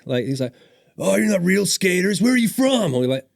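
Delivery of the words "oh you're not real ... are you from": high, loud retold interrogation; mock challenging shout. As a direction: imitate a skater loudly sizing up strangers. Phelps challenging the visitors.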